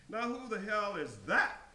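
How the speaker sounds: shocked voice. A narrator's reading. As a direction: ask it in shocked disbelief. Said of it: "a shocked voice said".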